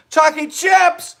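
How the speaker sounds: excited cheer. Real, not acted. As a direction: stretch the name out like a reveal. Showing off ingredient excitedly.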